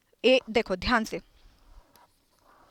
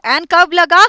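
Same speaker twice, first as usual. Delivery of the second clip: loud drill question; 5 semitones higher, 8 dB louder than usual. Shown as a demonstration. Teacher drilling the class on when to use an, loud questioning. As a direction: call out the question, high and loud. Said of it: "teacher drills class question".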